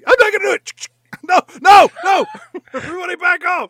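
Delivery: loud, high mock panic shouting; a frightened cop refusing to go in; hammed-up refusal. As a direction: yell it like a cop losing it. Voicing a cop refusing loudly.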